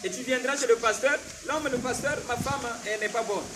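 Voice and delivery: fervent sermon delivery, rising and loud; preaching. Acted. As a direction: preach it to the congregation. Prédication en chaire. (prédication fervente)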